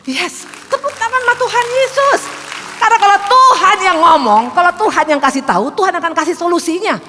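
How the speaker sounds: high, loud call to applaud God; rousing preaching. Real, not acted. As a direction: lift the congregation up with a shout. (seruan khotbah yang lantang dan tinggi)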